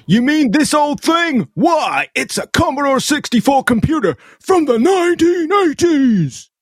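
very high, hammed-up ad enthusiasm; over-the-top energy. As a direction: gush like an overexcited infomercial sidekick. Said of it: "I like the energy".

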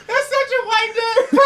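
high, slow, through laughter; laughing hard. Real, not acted. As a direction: crack up while saying it. Laughing at the name.

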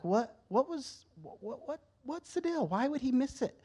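high, halting bewildered questions; puzzled questioning. Retold or imitated. Asking God about the servant, puzzled.

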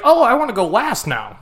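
high mocking voice; mocking whine. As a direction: mimic a whiny friend. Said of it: "It's like".

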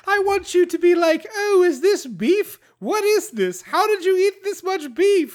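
very high mock-outraged voice; hammed-up demanding fairy. Co-host riffing as the kiss-demanding fairy, high hammed-up voice. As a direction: squeal it as an over-the-top fairy. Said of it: "and she's like".

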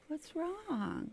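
high, very soft innocent question; sugary little voice, softly put on; mock sweet. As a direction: imitate a girl playing sweet and innocent. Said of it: "she's all sweet".

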